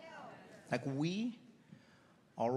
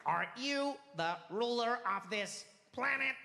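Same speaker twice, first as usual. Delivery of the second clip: slow alien question; drawn-out alien asking; 7 semitones higher, slower than usual. Retold or imitated. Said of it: "alien asks Trump".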